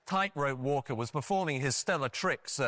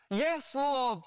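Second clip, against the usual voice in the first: high, mildly desperate voice; gasped reply; 10 semitones higher than usual. Retold or imitated. Hanging man answering God, in a high mock gasp. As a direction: imitate a desperate man gasping. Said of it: "the man gasped".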